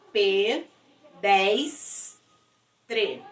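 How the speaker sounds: "P, des, tre" in separate syllables with pauses. Shown as a demonstration. Teacher splitting a word for pupils, syllable by syllable. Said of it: "eu vou separar as sílabas".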